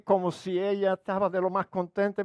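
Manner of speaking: ordinary talk, nothing marked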